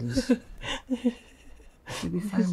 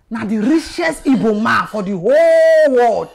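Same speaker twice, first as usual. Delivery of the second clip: loud, drawn-out boast, higher pitched; awed emphasis; 7 semitones higher, 12 dB louder than usual. Acted. Boss revealing who the girl's father is, emphatic awe. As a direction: stretch "whole world" out big and loud.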